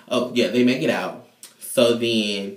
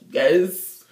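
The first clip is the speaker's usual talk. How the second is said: rising, louder and much higher, breaking into a laugh; cheering yes; 12 semitones higher, 7 dB louder, much slower than usual. Real, not acted. Narrator cheering Chanel walking out, loud and laughing. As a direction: hype her up like a fan.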